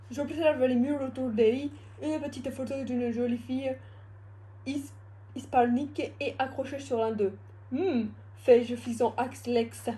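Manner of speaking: badly faked mix of Russian and English accents; clumsy mock Russian accent with some English; invented Russian-English accent. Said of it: "c'était un accent qui a l'accent russe et anglais mélangé".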